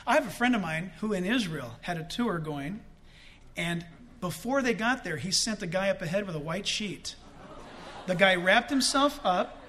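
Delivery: normal talking voice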